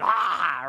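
loud acted "ah" of frustration; frustrated yell. Driver cut off in traffic before coffee, frustrated yell. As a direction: burst out as if at breaking point. Snapping under pressure without coffee.

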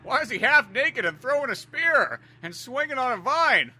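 high-pitched, hammed-up disbelief; mock horrified father. Acting the shocked movie father.

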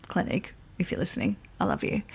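quick breathless whispered aside to the brand; hushed playful aside. Real, not acted. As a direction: murmur it like a secret love note.

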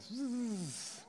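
high, soft buzzing hiss; imitated whizzing. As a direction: mimic line zipping away, softly. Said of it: "It just goes".

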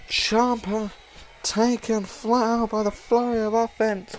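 ordinary talk

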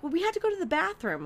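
high, breezy excuse; airy friend voice. Retold or imitated. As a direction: shrug it off lightly.

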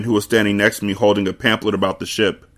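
ordinary delivery

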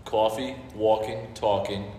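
rounded Jersey aw vowels, word by word; showing off New Jersey pronunciation; Jersey accent. Shown as a demonstration. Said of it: "deepest Jersey words that you can say".

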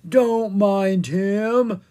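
very slow, loud drawn-out zombie moan; zombie groan. A narrator's reading. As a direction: drag every word like the undead. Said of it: "Ella groaned".

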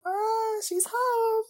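high, overcome cry of delight; moved squeal. Real, not acted. Viewer as the character gets home, moved squeal.

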